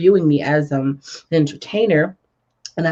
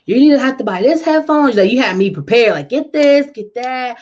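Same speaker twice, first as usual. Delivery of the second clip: demanding; 7 semitones higher, 5 dB louder than usual. Retold or imitated. Quotes mentor listing required gear.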